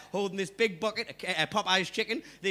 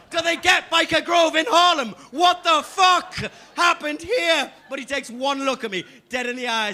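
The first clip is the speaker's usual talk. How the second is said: ordinary talk, nothing marked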